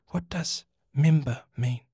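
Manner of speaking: plain reading voice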